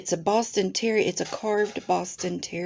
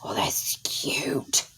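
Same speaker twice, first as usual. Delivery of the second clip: soft awed whisper; 4 semitones lower, 5 dB quieter, slower than usual. Real, not acted. Gushing over a new kit.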